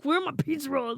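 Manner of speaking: high, loud indignant demand; mock-angry whine for snacks; mad brother. Brother demands pizza rolls from mom, mock angry. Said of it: "He was so mad".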